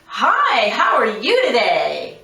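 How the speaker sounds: mock cheery telemarketer; pushy salesman voice. Shown as a demonstration. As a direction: oversell the greeting like a telemarketer. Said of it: "Oh, it's a salesman calling".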